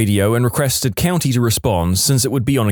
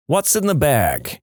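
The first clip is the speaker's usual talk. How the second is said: put-on Jersey accent; 3 semitones higher, slower than usual. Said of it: "in a really heavy Jersey accent".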